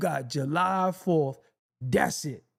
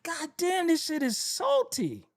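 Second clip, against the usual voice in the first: mock old complainer; 8 semitones higher, slower than usual.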